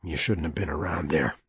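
low, rough and curt; rougher voice. Acted. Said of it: "his voice was rougher".

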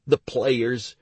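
ordinary delivery, nothing marked

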